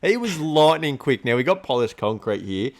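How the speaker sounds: ordinary talk, nothing marked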